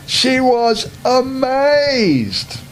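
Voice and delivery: slow rising storybook wonder. A narrator's reading. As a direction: stretch amazed up high with delight. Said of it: "repeated, higher and slower".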